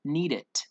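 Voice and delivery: "need it" with a sharp t in place of the final d; wrong t ending. Shown as a demonstration. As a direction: demo the mistake "need it", ending in t. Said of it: "We don't pronounce it".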